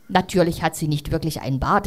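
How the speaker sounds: ordinary delivery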